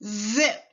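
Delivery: "zip." with a long voiced buzzing z; teacher drawing out the z in "zip.". Shown as a demonstration. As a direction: buzz the z of "zip." in the throat. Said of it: "Repeat after me".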